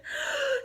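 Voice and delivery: sharp high gasp for air; loud gasp. Acted. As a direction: gulp air after running. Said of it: "he panted".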